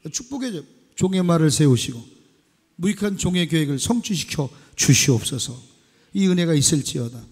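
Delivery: loud, ringing pulpit declaration; preaching at full force. Acted. Pastor proclaiming blessing to congregation, full-force preaching.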